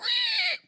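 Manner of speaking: high screeching dinosaur call; pterodactyl shriek. Shown as a demonstration. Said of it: "That's not a pterodactyl noise".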